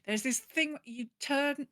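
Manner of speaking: ordinary talk, nothing marked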